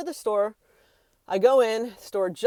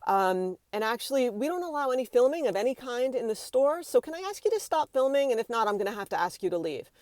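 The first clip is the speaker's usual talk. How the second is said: higher-pitched, courteous staff request; polite approach; 4 semitones higher than usual. Shown as a demonstration. Store worker asks a customer to stop filming, polite. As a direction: demonstrate a tactful, firm request. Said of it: "I would approach someone".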